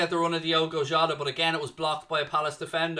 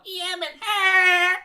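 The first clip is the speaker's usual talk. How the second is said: very high-pitched sitcom impression, ending in a loud squeal; Janice from Friends; 9 dB louder, much slower than usual. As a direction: squeal it in a put-on voice far above your own. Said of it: "from Friends, when, uh, Janice".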